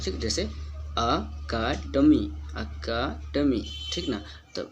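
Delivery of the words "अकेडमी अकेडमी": अकेडमी stretched slowly, beat by beat; slow teaching demo of अकेडमी; syllable-by-syllable model pronunciation. Shown as a demonstration. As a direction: sound out अकेडमी one syllable at a time. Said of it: "ई से अ के sound आता है".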